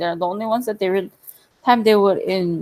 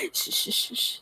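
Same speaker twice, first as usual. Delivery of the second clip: shushing whisper; 14 dB quieter than usual. Real, not acted. Hushing after laughing.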